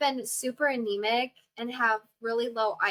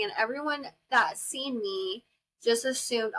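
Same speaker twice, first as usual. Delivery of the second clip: ordinary delivery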